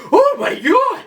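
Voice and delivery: shrieked high and loud; mock shocked gasp. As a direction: play the horrified bystander. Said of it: "They're like".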